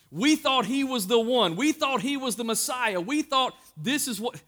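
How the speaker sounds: a touch of hammed-up dismay at lost hope; disappointed disciples. Disciples' dashed hopes voiced.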